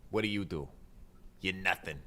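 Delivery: normal talking voice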